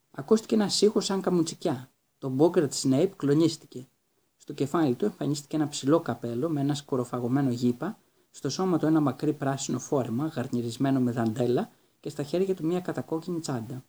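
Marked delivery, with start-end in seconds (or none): none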